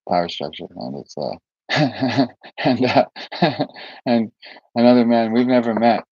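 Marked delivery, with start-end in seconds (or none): 1.7-2.6 short higher-pitched chuckle; quick laugh between words. Real, not acted. Telling of another man, a chuckle. 3.1-4.0 higher, airy chuckle. Real, not acted. Telling of another man, a chuckle.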